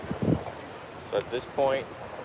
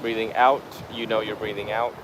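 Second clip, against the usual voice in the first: guided meditation; 6 dB quieter than usual. Real, not acted. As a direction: guide the breath unhurriedly, phrase by phrase. Leads a breathing meditation.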